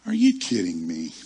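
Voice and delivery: low, loud mock disbelief; indignant disbelief. Passed-over preacher reacting to the snub, indignant disbelief in a low voice. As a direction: drop low and punch it, mock-offended.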